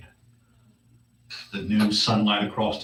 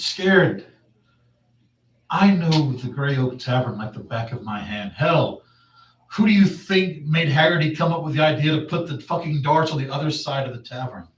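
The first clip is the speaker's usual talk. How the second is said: higher, louder indignant bluster; mock indignant; 6 semitones higher, 7 dB louder than usual. Halfling rejecting that he was scared in the tavern, indignant bluster. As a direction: scoff loudly at the very suggestion. [name] scoffs at being called scared.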